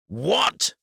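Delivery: loud high outburst of disbelief; outraged loud shout. A narrator's reading. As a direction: yell it in outrage. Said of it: "Eric's outrage was so loud".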